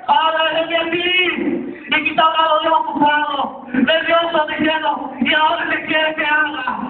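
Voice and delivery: high, driving preacher's delivery; impassioned sermon storytelling; full-force preaching. Real, not acted.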